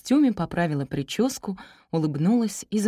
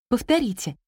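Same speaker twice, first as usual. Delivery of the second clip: higher, automatic teacher's request; automatic classroom command; 6 semitones higher than usual. A narrator's reading. Teacher addressing parents like pupils by habit, automatic. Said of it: "машинально попросила она".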